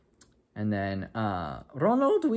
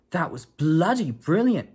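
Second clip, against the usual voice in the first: higher, louder, slow amazed praise; awed schoolboy; 7 semitones higher, 6 dB louder, slower than usual. Acted. Ron praises the transforming cat, slow and amazed. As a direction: marvel at what the cat just did.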